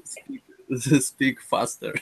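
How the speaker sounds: high, laughing through the words. Real, not acted. Student laughing at himself to the teacher, high and giggly. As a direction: giggle it out while explaining. Student jokes about being small.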